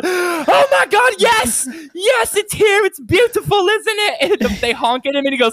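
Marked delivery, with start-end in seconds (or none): 0.0-4.4 high, loud imitation of ecstatic glee; freaking out. Imitating the trainspotter greeting his train, ecstatic high shouting. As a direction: squeal it like an overjoyed train fan. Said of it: "He's freaking out about it".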